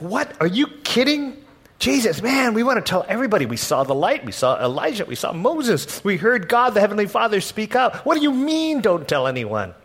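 incredulous protest. Retold or imitated. Disciples protest Jesus' order.

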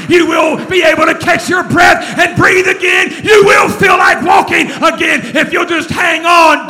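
loud, building, shouted sermon climax; preacher shouting hope to the congregation; full-force preaching. Acted. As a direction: rise to a roaring promise.

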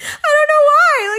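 a real half-laughing cry of frustration; high exasperated wail. Real, not acted. Venting about guys, high exasperated wail.

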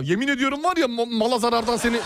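high, heated threatening yell; mock threat. Clerk threatens the customer over store damage, heated yell. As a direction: threaten him in a rising shout.

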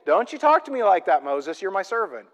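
ordinary talk, nothing marked

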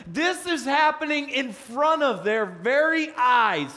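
preaching. Real, not acted. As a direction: build the scene with fire.

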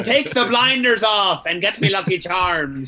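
hammed-up Irish accent, loud and slow; Magneto. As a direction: joke speech in exaggerated Irish oratory. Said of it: "I'm Irish, I can make these jokes".

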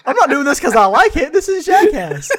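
high, loud whiny mock defense, half laughing; hammed-up high protest of a prankster; mocking Joey Salads. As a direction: play the caught troll insisting it's all a stunt. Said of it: "it's like, oh, if some guy".

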